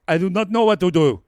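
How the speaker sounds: lightly voiced Usman with a hint of accent; a light take on his accent. Retelling Usman's answer to the producer, light accent. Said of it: "And Usman's like".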